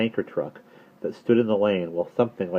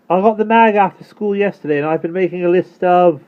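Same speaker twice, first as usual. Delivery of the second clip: loud, high call back over the shoulder; calling out while riding; 11 semitones higher, 9 dB louder than usual. Acted. Gian calls back to Omri while cycling, loud and high. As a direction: shout it ahead from the bike. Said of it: "His voice faded as he sailed".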